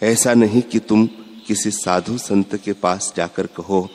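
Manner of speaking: ordinary talk, nothing marked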